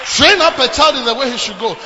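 loud scripture proclamation; booming preacher quote; preached shout. Acted. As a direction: belt the verse out to the church. Quoting scripture while shouting.

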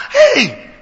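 sharp loud high cry of Hey; shouted exclamation. Acted. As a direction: bark out a startled Hey at full voice. Climax exclamation after rebuke.

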